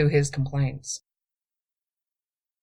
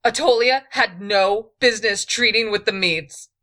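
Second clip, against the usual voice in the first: louder, higher heated retort; raised voice; 6 semitones higher, 7 dB louder than usual. A narrator's reading. Queen argues back with her thief, voice raised. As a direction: raise your voice at him. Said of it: "her voice raised".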